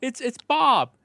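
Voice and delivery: high, overly sweet soap-opera voice; mock soap dialogue. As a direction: ham up a TV drama line, spelling everything out. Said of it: "got this guy saying".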